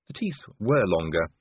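ordinary delivery, nothing marked

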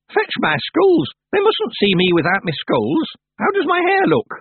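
put-on high, loud, panicked old witch; frantic. A narrator's reading. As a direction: bark the orders in a flustered rush. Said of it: "frantically winding up her clock".

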